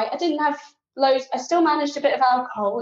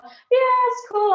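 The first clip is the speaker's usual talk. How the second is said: breezy reassurance; 13 semitones higher than usual. Retold or imitated. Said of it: "I'm like".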